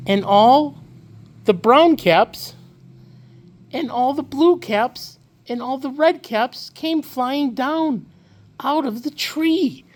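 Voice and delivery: rising delight at a marvel; wondering excitement. A narrator's reading. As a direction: let the wonder climb with each colour. Caps come flying down.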